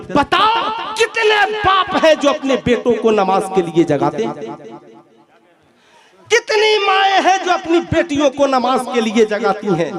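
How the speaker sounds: high-pitched challenge, falling through each question; impassioned preaching. Real, not acted. Preacher challenging parents about waking children for namaz, impassioned preaching. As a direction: demand an answer from the congregation.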